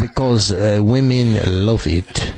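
halting mock Spanish accent; put-on Spanish accent. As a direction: play the suave Spanish accent women love. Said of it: "I'm talking the Spanish accent".

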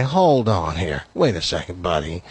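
skeptical pushback. Retold or imitated. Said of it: "and he goes".

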